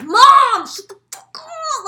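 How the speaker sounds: retold full-volume shriek at mom; high, very loud yell, losing it; exasperated scream. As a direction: scream as if driven crazy. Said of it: "I'm, like, going crazy".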